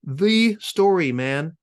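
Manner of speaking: slow, loud, high contemptuous spit; spat out. A narrator's reading. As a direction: spit the words at him. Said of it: "spat my previous friend".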